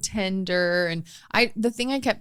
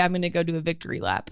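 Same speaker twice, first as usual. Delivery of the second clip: fast excited; 7 dB quieter, much faster than usual. Retold or imitated. Retelling waitress glee.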